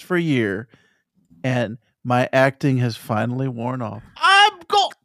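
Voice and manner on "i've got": very high, squeaky put-on voice; mock squeaky voice. Co-host clowning after admitting the accent act, squeaky voice. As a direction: shoot up into a comic falsetto squeal.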